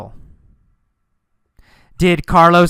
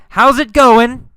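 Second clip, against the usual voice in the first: raised, high quoted call; yelled; 8 semitones higher, 5 dB louder than usual. Acted. Teacher confirming Carlos called to the girl, yelled. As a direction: call it out loud, lifting at the end. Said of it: "he yelled".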